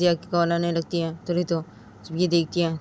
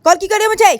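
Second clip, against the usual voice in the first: high, loud snapping into the phone; furious girl answering phone; angry answer; 12 semitones higher, 11 dB louder than usual. A narrator's reading. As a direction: bark hello at the caller. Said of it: "गुस्से में ... बोलती कि".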